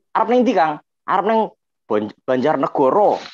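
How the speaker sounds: logat Jawa Solo, "Banjarnegoro" bervokal O. Acted. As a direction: tirukan obrolan orang Solo. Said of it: "kalau orang Solo". (logat Solo, vokal O bulat)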